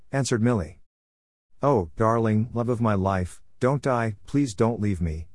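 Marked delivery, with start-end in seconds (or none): none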